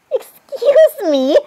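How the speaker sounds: high, slow, indignant drawl; exaggerated pretend indignation; mock offence. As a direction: stretch it out as if affronted. Acted offence at a remark.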